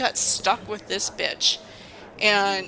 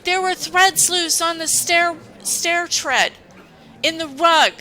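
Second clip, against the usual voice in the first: much higher, louder indignant voice; hammed-up boss complaint, pitched way up; mock angry boss; 9 semitones higher, 6 dB louder than usual. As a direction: boss complaining to her employee, imitated high and loud. Boss angry about rug, retold.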